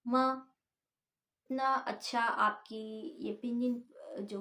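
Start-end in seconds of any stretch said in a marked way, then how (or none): 0.1-0.5 मा short and falling sharply from high; fourth tone. Shown as a demonstration. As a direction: drop मा quickly from the top. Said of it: "high और फिर लो". (मा छोटा, ऊपर से नीचे गिरता)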